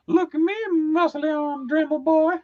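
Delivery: very high, slow mocking falsetto; Matt. Co-host mocking his friend at work, slow high impression. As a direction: tease in a drawn-out squeaky voice. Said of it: "when I'm talking as Matt".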